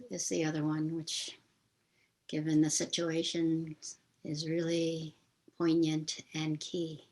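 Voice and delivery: measured meditation guide with long pauses; guided meditation. Real, not acted. Teacher leads listeners in a memorial meditation for George Floyd, guided meditation voice. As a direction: guide listeners gently, leaving space between phrases.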